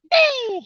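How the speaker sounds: high, celebratory "woo"; real whoop over a hooked fish; excited whoop. Real, not acted. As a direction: let out a high whoop of triumph.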